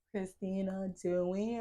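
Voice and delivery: low, quiet, flat teacher calling her name; monotone teacher. Retold or imitated. Teacher calling out the whispering student, low monotone. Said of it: "he was like".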